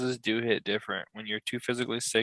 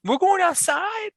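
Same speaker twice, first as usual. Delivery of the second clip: imitating a little girl, much higher and slow, hopeful question; excited toddler voice; 4 dB louder, slower than usual. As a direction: daughter begging to go play outside. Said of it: "Every day it's like".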